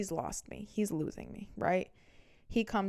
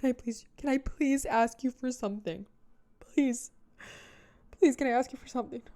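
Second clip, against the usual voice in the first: begging; 5 semitones higher, 3 dB louder than usual. Retold or imitated. Ex begging for a favor.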